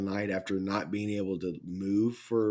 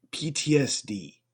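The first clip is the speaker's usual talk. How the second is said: ordinary delivery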